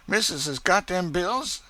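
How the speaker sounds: high, eager worried question; fretting she asks for the bills; anxious Chloe. Acted. Chloe anxiously asks her mistress for the bills, high worried voice. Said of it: "said Chloe anxiously".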